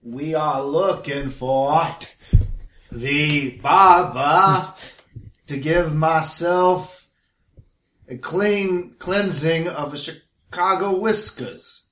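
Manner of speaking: hammed-up New York tough guy asking for a shave; New York accent. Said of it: "That's [name] doing a New York accent".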